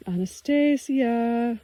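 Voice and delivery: long, high calling out of her name; joyful cry. A narrator's reading. Man calling to Anastasia across the glade, overjoyed. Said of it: "I cried out with an overflow of emotion".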